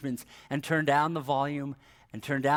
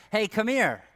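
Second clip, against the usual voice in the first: put-on raised, higher call to a child; parent calling; 5 semitones higher, 4 dB louder than usual. Parent summons one of the kids, calling out. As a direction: call the kid over.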